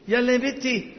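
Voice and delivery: high-pitched hurry-along; urging call. Real, not acted. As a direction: call her over, pitched well up. Urging a hesitant girl to come.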